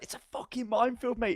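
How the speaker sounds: high, strained imitation of alarmed soldiers; mock exasperated warning. Soldiers telling the rescuer about the mines, mock alarm.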